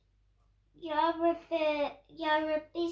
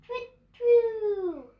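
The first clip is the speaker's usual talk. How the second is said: higher, louder chirps; bird tweets; 5 semitones higher, 8 dB louder than usual. Acted. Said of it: "Now say goodnight".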